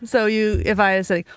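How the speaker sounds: usual speaking voice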